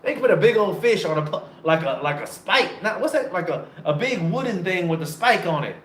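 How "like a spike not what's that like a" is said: ordinary delivery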